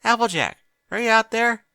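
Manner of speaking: loud high call through a door. Acted. Twilight calling Applejack from the shower, loud high call. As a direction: call out to find her.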